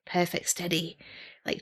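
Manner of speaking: ordinary delivery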